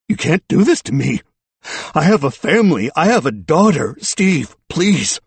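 high, loud desperate plea; voice breaking. A narrator's reading. Suspect begging his friend for mercy, voice breaking. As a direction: beg with the voice giving way. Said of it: "His voice broke as he said".

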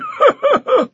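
booming roar of laughter. Acted. The champion mocks the small knight with a roar of laughter. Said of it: "roar of laughter".

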